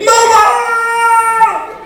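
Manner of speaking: high, loud terrified cry; screaming for mama. Retold or imitated. Thirteen-year-old in the cockpit wailing high for mama. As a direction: shriek for your mother as the jet climbs. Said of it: "you hear me, thirteen years old, saying this".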